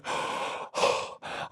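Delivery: sharp voiceless gasp of shock. Retold or imitated. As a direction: suck in air, stunned. Shock at the legends.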